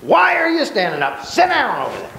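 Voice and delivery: teacher demoing a yell at a student; mock yelling. Shown as a demonstration. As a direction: call out to Sandy across the room. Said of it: "is me yelling at you".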